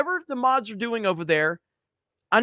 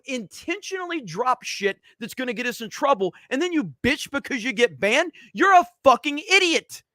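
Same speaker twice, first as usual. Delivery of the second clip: angry rant; 8 semitones higher than usual. Real, not acted. Streamer ranting at banned chatters, furious yelling. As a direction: blow up at the chatters in real anger. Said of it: "So, rant over".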